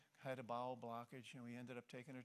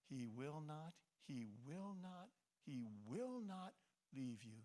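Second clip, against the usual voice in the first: each "not" in "He will not" leaned on, slow and rising; emphatic repetition. Shown as a demonstration. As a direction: press every "will not" harder, building. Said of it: "You see the emphasis, the strength of it?".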